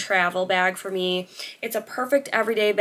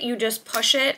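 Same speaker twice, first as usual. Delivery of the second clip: usual speaking voice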